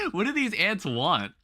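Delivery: normal talking voice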